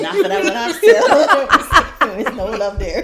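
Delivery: slow, laughing loud line. Real, not acted. Teasing friends about the oxtail, laughing loudly. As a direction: laugh through the joke.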